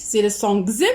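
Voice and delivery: voiced "gz" sound for the letter X. Shown as a demonstration. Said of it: "selon la prononciation".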